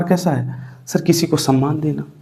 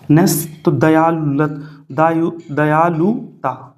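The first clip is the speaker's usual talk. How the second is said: slow, spelling out "दयालुता" as "नेस" joins "दयालु"; slow dictation; much slower than usual. Shown as a demonstration. Teacher slowly building "दयालुता" for the class to write. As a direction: draw the word out so students can write it. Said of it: "नेस जुड़ने के बाद दयालुता".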